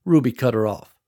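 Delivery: ordinary delivery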